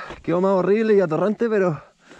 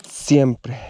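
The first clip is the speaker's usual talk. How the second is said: ordinary talk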